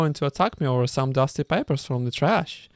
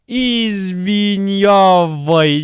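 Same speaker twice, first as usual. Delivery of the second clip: very slow, louder, higher; young man mumbling his apology; drawn-out apology; 6 semitones higher, 6 dB louder, much slower than usual. Acted. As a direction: stretch the word out like a sheepish youth. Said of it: "that's what he said".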